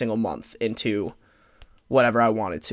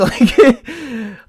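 a louder laugh at her own grim joke; dark-humour laugh; 9 semitones higher, 6 dB louder than usual. Real, not acted. Joking to listeners about dying young, a louder laugh. Jokes about being hit by a bus.

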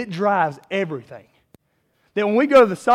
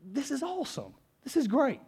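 hushed awe; 5 semitones higher, 10 dB quieter than usual. Retold or imitated. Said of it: "I'm like".

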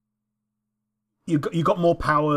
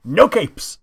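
quoted catchphrase; 4 semitones higher, 7 dB louder than usual. Retold or imitated. Quoting Edna Mode.

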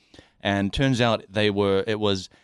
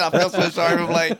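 mimicking through laughter; laughing quote; 7 semitones higher than usual. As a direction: quote her while laughing. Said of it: "She just said".

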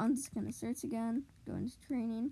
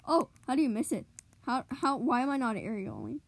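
ordinary talk, nothing marked

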